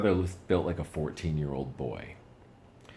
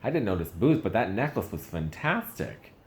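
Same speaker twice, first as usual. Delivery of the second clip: mock gushing; 4 semitones higher, 4 dB louder than usual. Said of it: "I was like".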